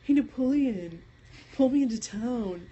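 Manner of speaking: Kip's voice. Retold or imitated. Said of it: "Kip's like".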